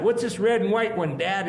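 lightly voiced eager boy; quick, high, curious kid question; eager child voice. Child asking father.

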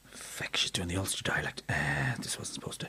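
hushed, fast whispered aside. Acted. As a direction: whisper it off to the side in a panic. Panicked aside during the oral exam.

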